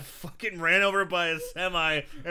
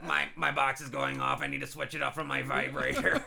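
smoker's rasp; 5 dB quieter than usual. Retold or imitated. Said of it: "She talks like this".

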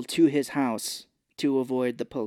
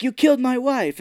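much higher and louder accusation; accusing shout; 11 semitones higher, 7 dB louder than usual. Retold or imitated. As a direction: hurl the accusation at him. Confronting DeVito in the car.

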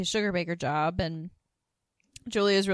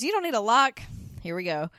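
higher and louder, a gung-ho mock bravado; imitating Suzanne; 7 semitones higher, 6 dB louder than usual. Suzanne recommending a Magnum over a lock, in higher, louder mock bravado. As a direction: sell the gun like it's obvious. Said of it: "Suzanne says".

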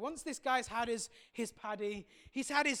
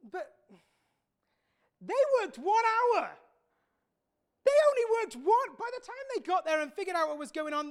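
much higher and louder, outraged; indignant complaint; 7 semitones higher, 7 dB louder than usual. Acted. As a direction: spit out the unfairness. Worker complains about latecomers.

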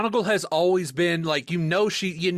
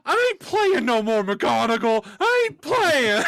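put-on a kid who's had it with everything; hammed-up furious tantrum; ranting Ron; 8 semitones higher than usual. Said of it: "and Ron's just like".

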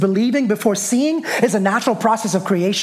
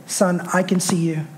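simple fatherly response; 3 dB quieter than usual. Retold or imitated. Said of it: "simply responded and said".

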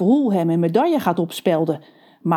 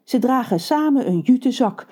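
plain reading voice

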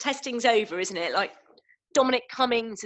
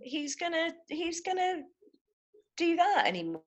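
high, soft disbelief; incredulous; 6 semitones higher, 5 dB quieter than usual. Real, not acted. Consultant doubting anyone would obey another lockdown, incredulous. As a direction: ask it high-pitched, truly incredulous.

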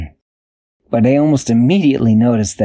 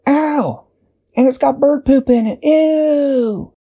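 hurt and grossed out; slower than usual. Acted. Dashi hurts knee in poop.

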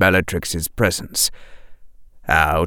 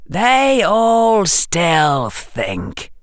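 cold Dark Lord voice; 11 semitones higher, much slower than usual. A narrator's reading. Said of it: "said Harry's voice".

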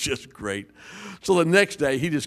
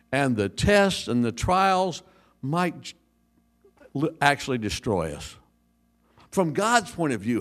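normal talking voice

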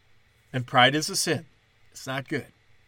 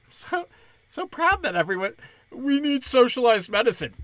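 mock pride; 9 semitones higher than usual.

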